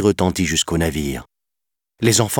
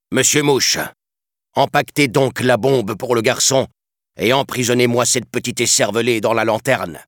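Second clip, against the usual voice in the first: higher, louder commanding captain; pirate captain's orders; 7 semitones higher, 3 dB louder than usual. A narrator's reading. Captain ordering his mate to pack the bomb, loud commands. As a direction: bark orders across the deck.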